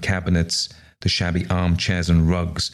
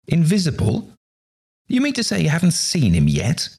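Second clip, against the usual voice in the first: high, incredulous questioning; acted amazement at something absurd; astonished disbelief; 11 semitones higher than usual. A narrator's reading. Guests astonished at invisible guardian.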